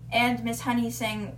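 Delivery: plain reading voice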